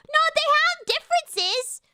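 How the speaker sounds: very high, loud objection; high-pitched, exasperated correction; indignant protest. Real, not acted. Objects to a chat comment.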